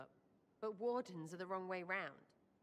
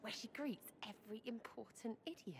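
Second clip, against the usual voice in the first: whisper; 3 semitones higher, 7 dB quieter than usual. Acted. Sneaking a prank, whispering plans.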